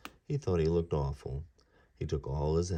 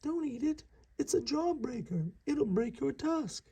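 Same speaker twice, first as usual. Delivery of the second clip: much higher squeaky mouse voice. Acted. Mister Mouse warning Ganesha, high squeaky cry. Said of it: "squeaked Mister Mouse".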